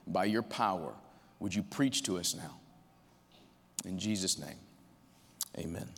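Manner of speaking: hushed, low prayer voice; quiet pastoral prayer before the sermon. Acted. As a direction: pray softly and reverently. Opening prayer.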